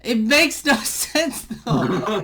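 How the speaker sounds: breaking into real laughter mid-complaint; laughing. Real, not acted. Laughs at co-host's joke.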